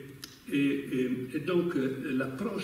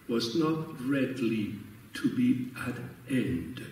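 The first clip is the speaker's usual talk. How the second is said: reading aloud; 2 semitones higher, slower than usual. Shown as a demonstration. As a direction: read the dedication slowly and deliberately, with a French accent. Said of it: "je vais lire ce passage-là".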